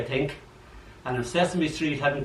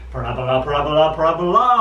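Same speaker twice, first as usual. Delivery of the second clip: rising chant, louder each time; hollering ball; 8 dB louder, much faster than usual. A narrator's reading. Ball hollering its flight path, building louder. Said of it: "hollers the ball".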